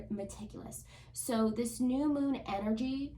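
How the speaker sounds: ordinary talk, nothing marked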